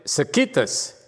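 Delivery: "circuitous" as sur-KIT-us; second-syllable stress. Shown as a demonstration. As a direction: try "circuitous" with the weight on KIT. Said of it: "The emphasis on the first syllable or second syllable?".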